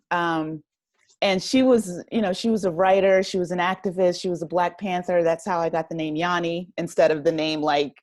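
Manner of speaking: ordinary delivery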